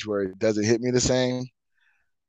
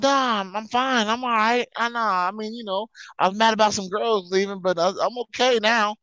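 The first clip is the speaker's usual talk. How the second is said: put-on drunk voice; 10 semitones higher than usual. Retold drunk self.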